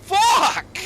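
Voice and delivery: startled shout. Real, not acted. Gamer jolted by a sudden scare, shouting. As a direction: blurt it out in real fright.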